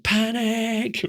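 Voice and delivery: high, soft mock panic. Reacting to news of war, a high mock-panicked squeak. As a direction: squeak it like a jolted fright. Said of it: "your immediate reaction was like".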